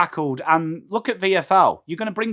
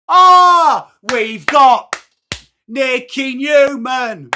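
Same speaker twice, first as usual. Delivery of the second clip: much higher, louder, slow drawn-out ring announcer call; slow hyped-up name call; announcer hype; 6 semitones higher, 8 dB louder, much slower than usual. Pretend. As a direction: boom out the name like a stadium MC. Hype call over music.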